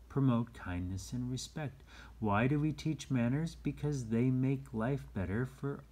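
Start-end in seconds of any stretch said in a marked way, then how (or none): none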